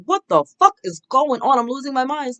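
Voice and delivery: panicked viewer. Retold or imitated. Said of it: "And you're like".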